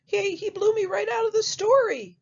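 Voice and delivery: high, surprised piglet voice; squeaky-high storybook pig; little pig. Acted. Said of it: "the pig says".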